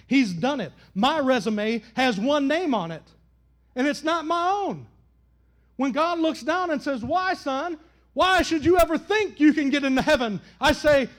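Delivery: loud, rising sermon peak, slowing on Jesus Christ; preaching crescendo. Acted. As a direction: build to a triumphant shout of faith. Preaching at full force.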